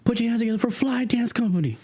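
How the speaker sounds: announcer hyping the crowd for the dance company; hype announcer. Retold or imitated. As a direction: whip up the crowd like an emcee. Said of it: "And then he goes".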